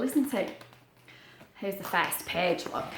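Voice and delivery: ordinary delivery, nothing marked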